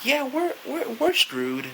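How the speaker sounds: high, whiny retold despair; mock crying. Fan telling himself the series is lost, mock crying. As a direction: drag it out in a high pretend whimper. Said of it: "I'm crying inside".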